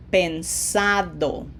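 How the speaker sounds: pensado slowly, with SA stressed; pensado, said slowly as pen-SA-do. Shown as a demonstration. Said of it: "Same thing with".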